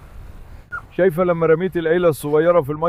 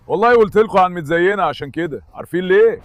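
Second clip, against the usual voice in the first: ordinary talk, nothing marked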